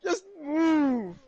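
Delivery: high, drawn-out mock plea; exasperated high-pitched cry; yelling neighbor. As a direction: wail it like a fed-up neighbor.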